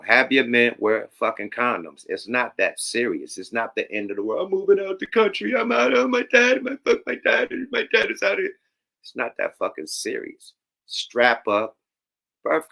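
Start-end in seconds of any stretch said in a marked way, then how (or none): 4.5-8.6 high, whiny mocking voice of a complainer; mock high whine; mock whiny girl. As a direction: squeal it like a spoiled teen moving out.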